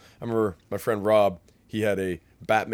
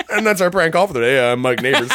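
cheesy radio host; 4 semitones higher, 11 dB louder than usual. Retold or imitated. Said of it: "he'd be like".

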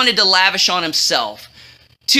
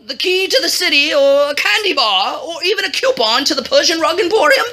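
higher-pitched, fumbling royal voice; blustering king; 6 semitones higher than usual. A narrator's reading. King quizzing his historian about a reward, high and blustery. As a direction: search for the words, pitched up.

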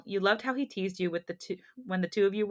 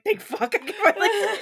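high-pitched laughing relief, much louder; squealing thank-goodness laugh; relieved laughing outburst; 13 semitones higher, 6 dB louder than usual. Retold or imitated. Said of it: "I was like".

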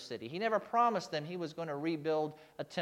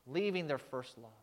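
ordinary delivery

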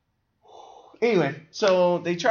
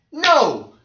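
much higher and louder protest; exasperated shout; 12 semitones higher, 11 dB louder, much slower than usual. Real, not acted. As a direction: refuse it outright. Ranting about the movie.

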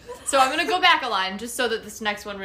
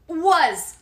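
loud sudden shout; all caps shout; 5 semitones higher, 10 dB louder than usual. Acted. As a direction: shout the word out. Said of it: "in all caps".

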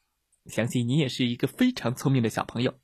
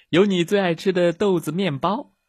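mother bear calling home, lightly raised; 6 semitones higher, 6 dB louder than usual. A narrator's reading. Mother bear calls her cub to dinner, calling out. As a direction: call the cubs home for dinner. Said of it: "熊妈妈招呼着".

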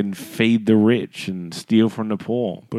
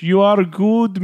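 high, loud, slow mock foreigner; mock broken English; 8 semitones higher, 6 dB louder, slower than usual. Co-host mimics fake followers' terrible English, high, loud and slow. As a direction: push it up high and stretch each word. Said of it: "they're terrible English?".

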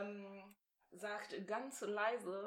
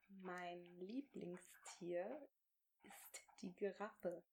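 slow, barely voiced words for lip-reading; near-silent mouthing; 3 semitones lower, 11 dB quieter, much slower than usual. Shown as a demonstration. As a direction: mouth each word slowly and softly. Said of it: "game, mouthing silently for lip-reading".

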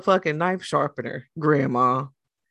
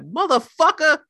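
higher, louder mock cuss; angry outburst; 11 semitones higher, 6 dB louder than usual. Retelling mom's reaction at the Eat More, mock shout. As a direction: imitate mom snapping at being hit by the cart. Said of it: "she turned around and was like".

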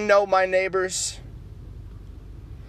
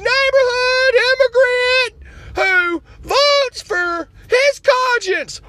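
high, loud indignant activist voice; outraged liberal caricature; 6 dB louder, slower than usual. Acted.

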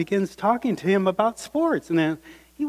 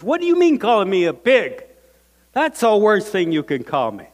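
indignant retort; 4 semitones higher, 6 dB louder, faster than usual. Retold or imitated. Brother angry at sister's insult.

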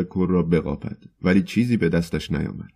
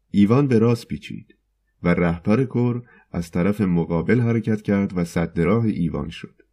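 ordinary delivery

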